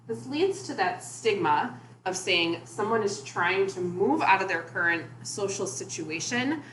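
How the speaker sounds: normal talking voice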